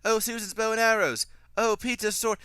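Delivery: high, loud exaggerated exclamations; mock film dialogue. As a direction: parody characters pointing out the obvious. Mocks characters announcing obvious objects.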